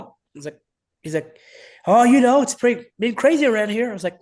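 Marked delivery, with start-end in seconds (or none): 1.9-3.9 high, loud retold phone reply; lightly acted coach's answer; imitating the coach. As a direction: voice the coach, pitched up and louder.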